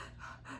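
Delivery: re-enacted tearful gasp; gasping for breath. Sister calling with news, acted-out gasp. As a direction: suck in air as if too tearful to talk. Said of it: "couldn't catch my breath to tell".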